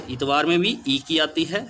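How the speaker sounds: usual speaking voice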